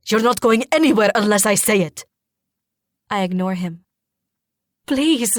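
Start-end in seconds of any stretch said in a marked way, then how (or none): 0.1-2.1 loud outraged father; angry shout. A narrator's reading. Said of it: "Father shouts angrily". 4.9-5.3 high-pitched pleading; begging. A narrator's reading. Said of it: "I beg".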